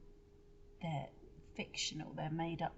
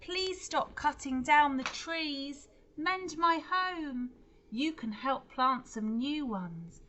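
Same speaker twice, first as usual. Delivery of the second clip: loud high protest chants; slow, loud pleading cries; crowd shouting; 7 semitones higher, 8 dB louder than usual. Acted. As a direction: shout demands at the giants. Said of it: "everyone in the crowd began to shout".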